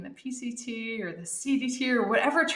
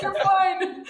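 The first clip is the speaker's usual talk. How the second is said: higher, louder, brushing it off; dismissive teammate; 9 semitones higher, 5 dB louder than usual. Retold or imitated. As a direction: wave off the injury bluntly. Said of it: "She's like".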